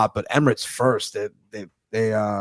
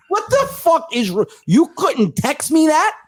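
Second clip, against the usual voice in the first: high, drawn-out incredulous protest; exasperated outburst; 3 dB louder, slower than usual. Retold or imitated. Man scolding cousin for indiscretion, mock exasperated. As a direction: stretch it out, pitched way up, mock outraged.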